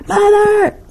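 high, loud call of "Mother!". Retold or imitated. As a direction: call across the room to get her attention. Sister calling her from afar.

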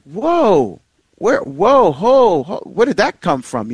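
drawn-out, swooping mock shock; exaggerated whoa of surprise; taken aback. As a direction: reel back from an unexpected jab. Retold shock at wife's comeback.

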